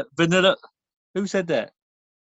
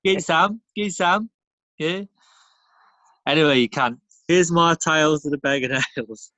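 put-on a light London geezer accent. Pub regular greeting his mates, lightly done London geezer accent. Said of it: "I can kinda do that accent".

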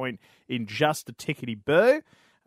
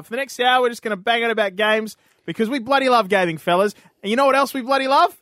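pumped, louder matey host opener; hammed-up host hype; 10 semitones higher, 8 dB louder than usual. Host revving up co-hosts for the show, hammy excited hype. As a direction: rev the boys up for the hour.

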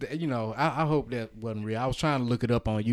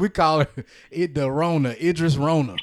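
playful announcement; 2 semitones higher, 8 dB louder than usual. Pretend. Joking nickname, louder.

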